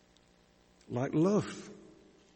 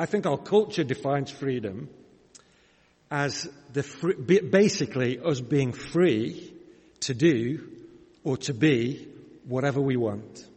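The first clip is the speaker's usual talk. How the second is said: ordinary talk, nothing marked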